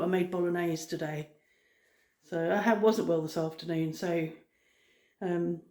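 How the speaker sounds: ordinary talk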